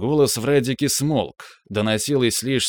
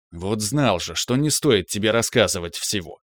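usual speaking voice

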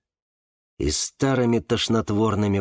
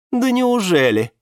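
high, louder sarcastic retort; mocking; 11 semitones higher, 5 dB louder than usual. A narrator's reading. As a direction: jeer with exaggerated doubt. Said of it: "насмешливо отозвалась Валериана". (насмешливо, с издёвкой)

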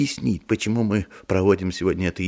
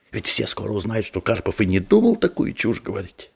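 ordinary talk, nothing marked

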